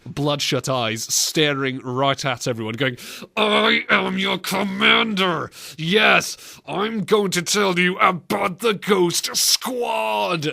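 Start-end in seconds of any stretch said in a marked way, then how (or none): 3.4-10.5 mock ticked-off commander, high and slow; gritted teeth. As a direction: force each word out through gritted teeth. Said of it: "like, gritted teeth".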